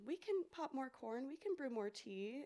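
lightly voiced tiny mouse offering food; little mouse. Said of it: "this little mouse comes up and says".

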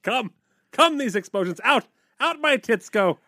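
hammy stage actor. Acted. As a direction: declaim grandly like a ham. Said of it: "is that [name] or Shakespeare".

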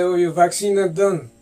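normal talking voice

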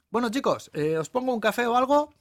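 high, loud, cheery offer; perky waitress. Acted. Waitress offering the couple coffee, perky and high. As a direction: sing out the offer, pitched well up.

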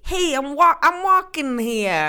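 put-on loud, brash New York street accent; Brooklyn accent. Reading a listener's email in a mock Brooklyn accent. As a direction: bark it like a Brooklyn cabbie. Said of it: "That's my accent".

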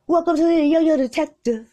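loud, high TV host intro; announcer voice. Pretend.

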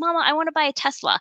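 mimicking a little boy. Mom imitating her mesmerized son wanting a Tesla, fast and high. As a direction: rattle it off like an eager four-year-old, lightly voiced. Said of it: "he goes".

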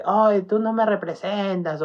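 high, slow, sneering jab; lightly mocking falsetto put-down; mock heckle. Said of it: "así como que".

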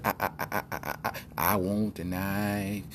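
lightly imitated stuttering plea; slow, stuttering mock plea; Eddie Murphy. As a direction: stammer on "I", then stretch the request out slowly. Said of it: "And Eddie Murphy said".

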